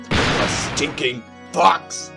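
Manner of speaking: loud, slow, high-pitched contempt; disgusted insult. Acted. Insulting rival's species.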